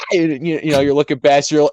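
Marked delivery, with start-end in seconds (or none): none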